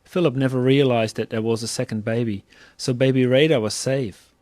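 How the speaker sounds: ordinary delivery, nothing marked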